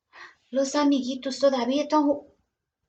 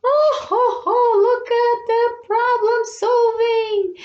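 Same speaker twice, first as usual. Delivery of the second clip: delighted laugh; 11 semitones higher, 5 dB louder than usual. Real, not acted. Teacher delighted at ending.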